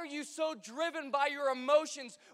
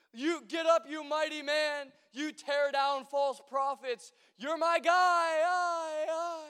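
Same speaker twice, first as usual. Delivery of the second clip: louder, higher pep-talk voice, slower; hyped-up God; 4 semitones higher, 6 dB louder, slower than usual. Retold or imitated. Mock God cheering on his prophet. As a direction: hype him up like a coach. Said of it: "God's not like".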